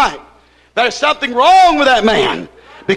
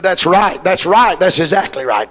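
imitating the man; 5 semitones lower than usual. Preacher mimics the visiting man agreeing, lower voice. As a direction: echo his agreement the way he said it. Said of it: "That's the way he said it".

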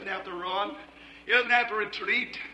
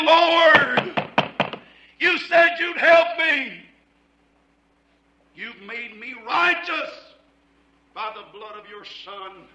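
bold pleading prayer; 5 semitones higher, 4 dB louder, slower than usual. Acted. Bold prayer before the throne.

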